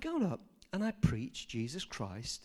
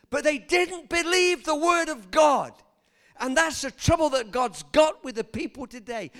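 preaching at full force; 11 semitones higher, 11 dB louder than usual. Acted. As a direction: thunder it from the pulpit.

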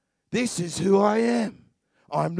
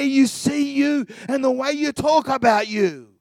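louder, driving sermon cadence; full-force preaching; 4 semitones higher, 4 dB louder than usual. Real, not acted. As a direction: pound the promise home to the congregation.

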